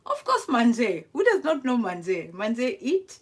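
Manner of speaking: loud, laughing boast over a French word; loudly proud of a word everyone knows; excited. Acted. Cooking host shows off her French to viewers, loud and laughing.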